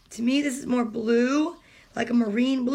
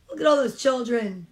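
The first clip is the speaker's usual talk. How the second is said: plain reading voice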